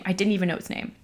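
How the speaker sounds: usual speaking voice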